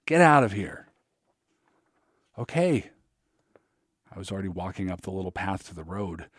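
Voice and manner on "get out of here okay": shouting. A narrator's reading. Shouting exchange continues.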